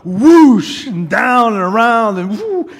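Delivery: high, loud thrilled whoosh; shouted rollercoaster thrill; coaster whoosh. Retold or imitated. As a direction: ride the drop with your voice.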